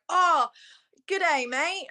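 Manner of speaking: loud, high mock-Australian greeting; imitated Aussie greeting. International girls greeting her on seeing her sash, mock Aussie accent. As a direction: foreigner putting on an excited Aussie accent. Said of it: "every international girl, when they saw my sash".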